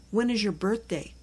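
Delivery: ordinary delivery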